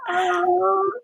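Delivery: choked up with gratitude; tearful thanks on Thanksgiving; real tearful. Real, not acted. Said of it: "This is making me cry".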